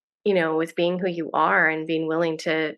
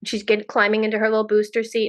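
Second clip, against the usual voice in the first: usual speaking voice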